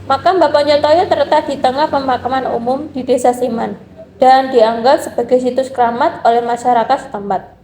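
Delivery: normal talking voice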